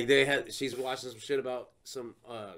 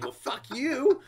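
mock hostile; 10 semitones higher, 5 dB louder, slower than usual. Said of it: "I would get all hostile".

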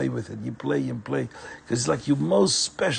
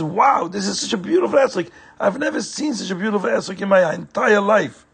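higher, awed delight; amazed; 7 semitones higher, 3 dB louder than usual. A narrator's reading. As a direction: marvel at it, wide-eyed. David marvels at esrog.

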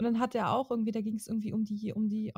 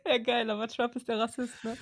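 laughing. Real, not acted. Still laughing.

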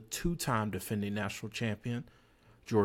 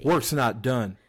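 louder with full intensity; intense, loud delivery; 7 dB louder than usual. Shown as a demonstration. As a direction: punch it out with real intensity. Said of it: "you need to say that with intensity".

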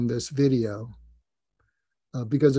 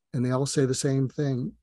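ordinary talk

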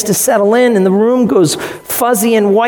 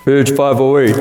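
deep voice; 5 semitones lower than usual. Shown as a demonstration. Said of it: "say it with a deep, loud voice".

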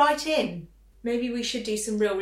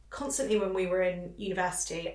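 normal talking voice

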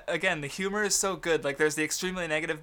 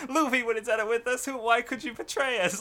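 higher-pitched complaint through a laugh; mock betrayed protest; 6 semitones higher than usual. Crew accusing Robin, playfully betrayed. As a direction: whine the accusation in good-spirited mock hurt.

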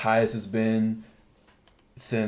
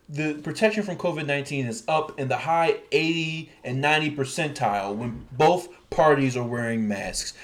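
ordinary delivery

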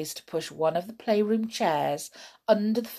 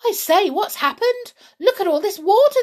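much higher, louder astonished cry; 14 semitones higher, 9 dB louder than usual. Acted. Children finding the flooded playroom, astonished cry. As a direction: gasp at the flooded floor. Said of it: "they cried".

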